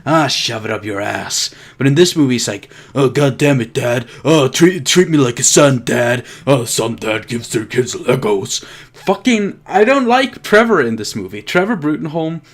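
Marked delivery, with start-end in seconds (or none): 0.0-1.5 lightly voiced weary dismissal, lower; dismissive attitude. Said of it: "he has this attitude of". 2.9-8.6 lightly mocked sulky teenager; stupid teenager. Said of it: "acts like a stupid fucking teenager".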